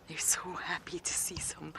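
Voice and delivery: soft hushed whisper at the den. Real, not acted. Keeper watching cubs, whispering to viewers. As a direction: whisper it gently.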